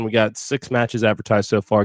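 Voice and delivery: usual speaking voice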